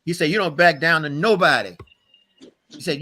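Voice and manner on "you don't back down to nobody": raised pitch, loud and emphatic; grandfather's firm order. Retold or imitated. Grandfather teaching his grandson to stand firm, loud and emphatic. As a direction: lay it down forcefully as a rule.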